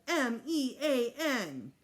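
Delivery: put-on slow, loud, crying little-boy wail of each letter; child spelling it out. Little Fudge wailing at the teacher, slow and loud. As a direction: spell it out like a wailing small kid.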